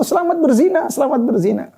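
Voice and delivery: high-pitched, cheery mock congratulation. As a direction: congratulate them with exaggerated, lilting approval.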